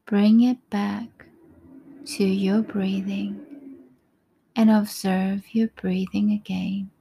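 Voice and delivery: ordinary talk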